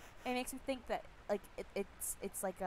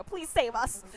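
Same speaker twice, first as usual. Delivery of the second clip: louder mock plea at usual pitch; acted plea; 2 semitones higher, 8 dB louder, slower than usual. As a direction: plead firmly with the hero. Townsperson pleads with hero.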